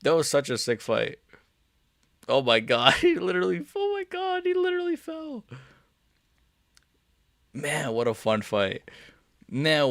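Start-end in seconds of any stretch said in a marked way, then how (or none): 3.0-5.4 high, laughing squeal; laughing disbelief. Real, not acted. Streamer replaying the knockout to chat, laughing.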